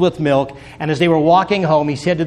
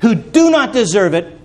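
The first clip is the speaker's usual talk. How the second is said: rising preacher's cry on "Unmerited favor"; full-force pulpit build then drop; preaching; 2 semitones higher than usual. Acted. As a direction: lift the congregation, then fall back.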